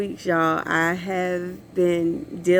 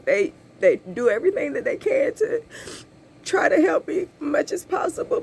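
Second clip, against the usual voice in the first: crying; 6 semitones higher than usual. Real, not acted. Tearful talk about family support.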